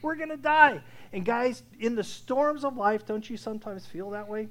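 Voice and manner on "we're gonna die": high, loud terrified plea; panicked pleading. Retold or imitated. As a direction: cry out as if about to drown. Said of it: "the disciples are all panicking".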